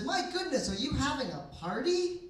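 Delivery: high, swooping woman's voice; nosy lady in line. Retold or imitated. Lady in line asking comedian about his meat, high astonished imitation. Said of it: "Lady in line behind me".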